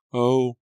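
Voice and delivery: sharp gasp of pain at a cut; high sudden "oh" of pain; pained cry. Acted. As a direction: cry out as the glass cuts.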